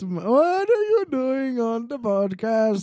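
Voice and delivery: put-on high, slow Cosby sliding toward Indian accent; Indian-sounding Cosby. Co-host teasing the other's impression, Indian-tinged Cosby accent. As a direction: draw it out with an Indian-accent lilt. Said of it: "so close to an Indian accent".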